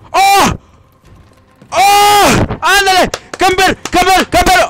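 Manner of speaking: loud high triumphant yelling; excited yells. Real, not acted. Gamer celebrating first place, loud high triumphant yells. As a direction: shout camper in triumph.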